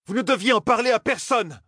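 very high-pitched furious outburst; enraged roar. A narrator's reading. Aes Sedai rebuking an eavesdropped-on companion, enraged high roar. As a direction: roar it at the top of the range. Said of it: "rugit la Aes Sedai, folle de rage".

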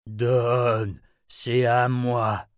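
slow, drawn-out creature voice; demanding Samuel. A narrator's reading. Samuel claims the sword from Richard, slow greedy demand. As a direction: stretch each word, hungry for it.

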